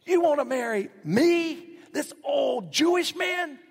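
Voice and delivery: high, loud, slowing disbelieving shout; shocked disbelief. Acted. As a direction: cry out in astonished disbelief that she'd want him. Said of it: "He's going".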